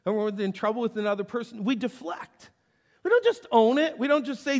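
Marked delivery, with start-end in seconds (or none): 3.0-4.6 high, loud insistence; loud, pressing preacher call; preaching emphasis. Acted. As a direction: drive the point home hard.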